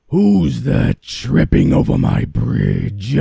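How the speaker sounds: loud, high troll roar. Acted. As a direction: bellow it like a angry troll. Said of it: "roared the troll".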